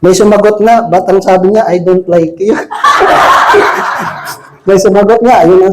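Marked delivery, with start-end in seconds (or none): 1.6-2.5 quoting the rejection through a laugh. Retold or imitated. Retelling a rejection as a joke. 2.6-4.3 high laugh. Real, not acted. Punchline of a joke.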